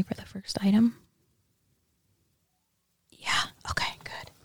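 hushed ASMR voice; ASMR whisper. Acted. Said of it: "you don't have to whisper into the mic".

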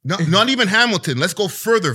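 usual speaking voice

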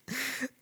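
sudden high-pitched laugh; high burst of laughter. Real, not acted. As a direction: let a squeaky laugh burst out. Laughing at own joke.

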